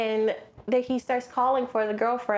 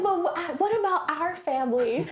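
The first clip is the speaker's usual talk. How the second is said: high, stammering plea; a whiny husband begging her to stay; pleading husband; 7 semitones higher than usual. Retold or imitated. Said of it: "He's like".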